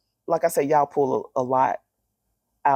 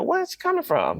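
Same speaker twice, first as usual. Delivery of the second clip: high, puzzled mock question; puzzled listeners. A woman mimicking puzzled listeners, high and surprised. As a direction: play the baffled listener asking where she came from. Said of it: "they're like".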